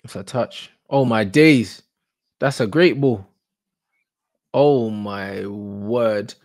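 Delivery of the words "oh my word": long drawn-out stunned gasp of words. Real, not acted. Watching a great ball, drawn-out stunned awe. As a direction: let it fall slowly in disbelief.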